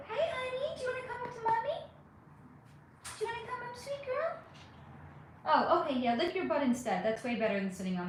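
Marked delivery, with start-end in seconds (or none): 0.1-4.4 much higher, softer pet-talk coo. Real, not acted. Owner coaxing her cat onto her lap, much higher soft baby talk. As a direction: coax the kitty up onto your lap sweetly.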